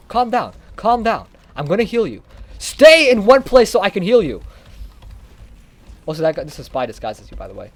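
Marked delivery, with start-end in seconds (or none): 0.1-4.4 higher, louder call to a teammate. Real, not acted. Medic calling to teammate Scout to stay put, raised voice. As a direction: tell him to hold still.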